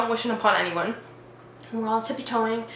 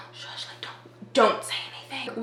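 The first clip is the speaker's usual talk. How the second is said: imitated whispered warning; urgent hushing; 5 dB quieter than usual. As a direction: hush someone while hiding. Kid hushing her while hiding.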